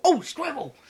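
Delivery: high, loud burst of excitement; sudden distraction. Retold or imitated. Vlogger acting out his ADHD, sudden excited squeal. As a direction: blurt it out like a dog spotting a squirrel. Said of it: "then go".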